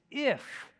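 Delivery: a high, emphatic IF; stress on IF. Real, not acted. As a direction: lean hard on the word "if". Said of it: "I emphasize the word if".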